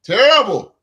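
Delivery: a sudden loud, high "Terrible."; retold outburst. Retelling his reaction to the article, loud and high. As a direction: burst out "Terrible." in disgust. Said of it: "And I said".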